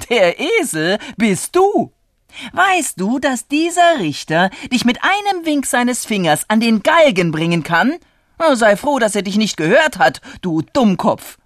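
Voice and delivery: loud, high-pitched indignant rebuke; exasperated, heated dressing-down; scolding. Acted. Onlooker berates the foolish farmer.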